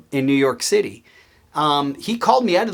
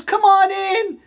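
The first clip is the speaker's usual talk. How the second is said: calling out; slower than usual. Retold or imitated. Boss calls the pranked new hire inside, imitated high holler.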